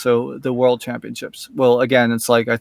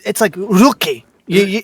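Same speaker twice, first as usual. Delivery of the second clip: imitating Grookey; 6 semitones higher than usual. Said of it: "like a rough tone".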